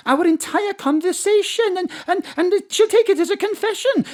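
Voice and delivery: high-pitched, flustered worry; mounting panic. Acted. Warning that Astrid will take it as a confession, panicky. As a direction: get worked up, voice climbing high. Character panics about being found out.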